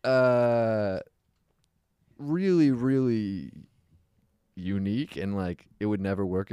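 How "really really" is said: ordinary talk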